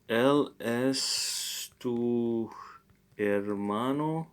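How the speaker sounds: very slow, word by word; slow repeat. Shown as a demonstration. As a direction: drag it out slowly for learners. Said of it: "repeating after recording".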